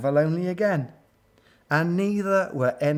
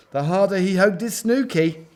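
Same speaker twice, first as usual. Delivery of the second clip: higher, lilting storytelling; animated, higher-pitched narration; building up; 6 semitones higher, 4 dB louder than usual. A narrator's reading. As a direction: lift the refrain as it snowballs. Refrain builds up again.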